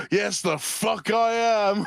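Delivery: high and slow, drawn-out gleeful cheer; mock hyped gamer cheer; excited yes. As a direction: stretch it out high like you just won. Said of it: "He goes".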